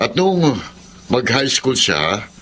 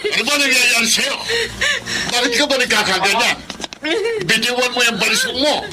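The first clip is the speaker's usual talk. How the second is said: alarmed shouting; 13 semitones higher than usual. Acted. Father yelling at his son to drop the knife. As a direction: shout at him to stop, alarmed.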